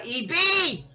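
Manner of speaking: loud, high shout-out; loud cheer for a favourite singer; calling out. Real, not acted. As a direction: hail her like a fan. Calling out to [name].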